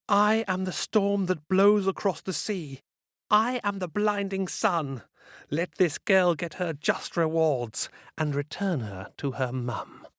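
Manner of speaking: high, dark incantation; ominous witch spell. Acted. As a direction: chant the spell as a threat. Witch repeats spell, storm version.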